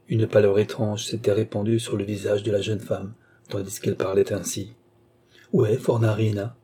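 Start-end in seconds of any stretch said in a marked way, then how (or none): none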